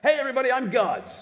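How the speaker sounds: quick, high, loud proclamation; mock public announcement. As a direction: rattle it off as a shout to all. Replays the announcement.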